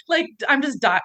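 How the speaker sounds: laughing hard; cracking up mid-sentence; real laughter. Real, not acted. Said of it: "I just pissed myself".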